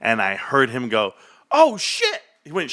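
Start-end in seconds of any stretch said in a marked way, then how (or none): 1.5-2.2 high-pitched imitation of a kid cursing; imitated kid's cussing. Dad imitating his son cursing at Call of Duty, high outburst. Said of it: "He went like that".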